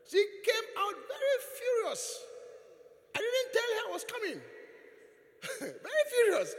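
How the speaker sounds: high, loud, worked-up telling; high excited exclaim; excited retelling. Real, not acted. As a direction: pitch it up as if still shocked. Retelling her fury, excited.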